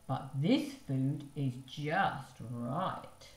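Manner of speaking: slow satisfied. Acted.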